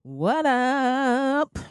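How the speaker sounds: a big stretched-out podcast hello; hype greeting. Real, not acted. Host calling out to her listeners, big hello. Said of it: "podcast greeting".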